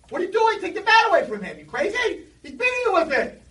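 high, loud panicked shouting; alarmed yell. Retold or imitated. Neighbor alarmed at father.

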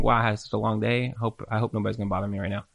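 soft, tired mumble of a worn-out husband; quiet, mildly imitated exhausted husband; weary husband. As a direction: sigh it like he's drained. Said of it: "he's thinking".

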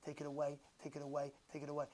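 low, hushed, repeated plea; quiet begging. Acted. Sufferer privately begs Hashem for relief, soft low plea. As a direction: beg softly and humbly. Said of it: "you start begging him".

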